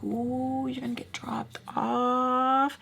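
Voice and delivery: soft, high, drawn-out singsong tease; teasing taunt. Retold or imitated. Messing with Sam about being left behind, teasing.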